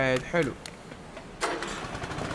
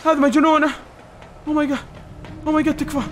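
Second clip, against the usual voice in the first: usual speaking voice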